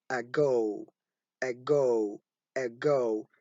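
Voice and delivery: low model "ago", neutral first vowel; normal schwa. Shown as a demonstration. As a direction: repeat "ago" with a schwa at the beginning. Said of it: "The normal schwa".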